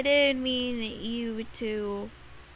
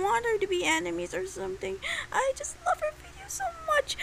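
crying voice; 11 semitones higher, faster than usual. Real, not acted. Emotional plea about friend.

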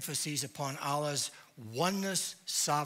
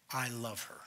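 tender; 6 semitones lower, 6 dB quieter than usual. Retold or imitated. God's answer voiced, tender.

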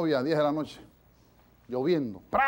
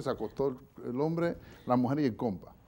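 ordinary delivery

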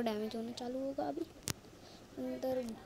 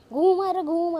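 sudden loud outburst; yelled protest at full volume; loud exclamation; 3 semitones higher, 12 dB louder than usual. Real, not acted. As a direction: burst out loudly as you get spun around. Spun around while being attacked.

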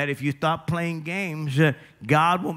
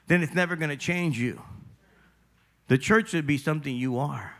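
usual speaking voice

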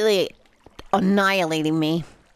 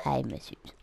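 soft whispered pun, much quieter; whispering; 8 semitones lower, 11 dB quieter than usual. Shown as a demonstration. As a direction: whisper the joke again. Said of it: "Get it?".

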